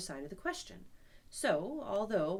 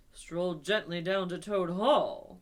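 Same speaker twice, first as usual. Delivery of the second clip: "Stroll gently down to Toad Hall" slow and louder; excited echo; 2 semitones lower, 8 dB louder, slower than usual. Acted. Rat mocking Toad's "Stroll gently down to Toad Hall", excited. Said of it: "cried the rat, greatly excited".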